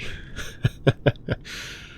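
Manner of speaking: breathy amused chuckle. Acted. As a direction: chuckle along. Amused by the fib.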